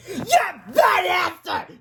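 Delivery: put-on high, loud drawn-out kid's cry; crying Melvin. A narrator's reading. Spanked boy protesting to the class, crying out. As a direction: wail it out like an upset child. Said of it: "Melvin cried".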